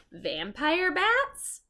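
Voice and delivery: high, slow, questioning title; spooky title read. Shown as a demonstration. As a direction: draw out the title with rising suspense. Reading the spooky chapter title.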